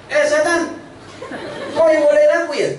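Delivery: high, laughing challenge; mock accusing devil. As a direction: call out the devil playfully. Addressing the devil.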